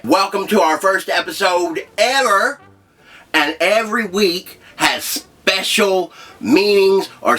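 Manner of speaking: high, slow announcer voice with a grin; hyped show host intro. Acted. Host welcoming viewers, high and slow announcer voice. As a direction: sell it like a goofy game-show host.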